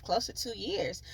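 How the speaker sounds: ordinary delivery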